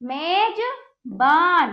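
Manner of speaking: very slow, words split into stretched parts; teacher sounding out long words piece by piece; broken-up reading. Shown as a demonstration. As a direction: hold each syllable long and pause inside the word. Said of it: "तो इस प्रकार हमने इसको पढ़ा".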